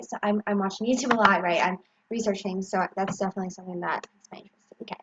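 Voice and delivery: ordinary delivery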